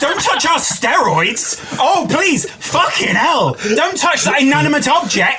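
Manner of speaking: high, hammed-up indignant protest; mock outrage. Imitating outraged fans over steroids, mock indignation. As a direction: wail it like an offended fan.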